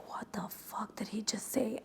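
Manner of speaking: hushed, breathy aside of disbelief; shocked whisper. Retold or imitated. Recapping Summer House, the stunned cast whispering in shock. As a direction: whisper it like the whole table is stunned. Cast reacting at dinner table.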